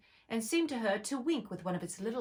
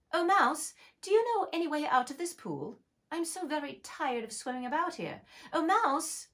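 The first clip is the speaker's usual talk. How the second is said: higher, louder, grand calling out; lightly voiced girl hailing a mouse grandly; formal address; 9 semitones higher, 3 dB louder than usual. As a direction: call to the mouse with mock ceremony. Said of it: "the right way of speaking to a mouse".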